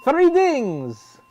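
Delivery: high, loud drawn-out call; big playful shout; excited announcement. Pretend. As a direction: sing out the cue like a game host. Calls out the next voice cue.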